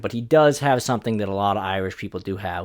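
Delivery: usual speaking voice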